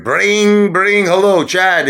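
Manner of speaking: high bouncy mock phone ring, then a cheery hello. Acting out calling Chad, a high mock ringtone and hello. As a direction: sing the ring brring brring, then greet Chad.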